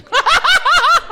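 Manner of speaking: high, loud burst of real laughter. Real, not acted. Telling a friend about the beating, breaking into loud laughter. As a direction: crack up at your own story.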